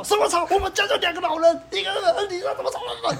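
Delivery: very high, laughing angry rant; furious retort. Retold or imitated. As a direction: tear into the neighbor. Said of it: "反正我就暴怒".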